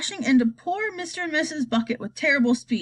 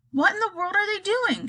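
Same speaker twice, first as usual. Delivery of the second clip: shouting; 9 semitones higher than usual. A narrator's reading. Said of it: "shouted the President".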